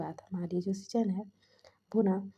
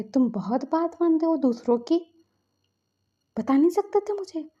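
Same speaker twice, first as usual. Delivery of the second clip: loud, higher angry reproach; 6 semitones higher, 5 dB louder than usual. Acted. Elder brother reproaching friend for obeying others, angry. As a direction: demand an answer, furious. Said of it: "season बहुत गुस्से में थे".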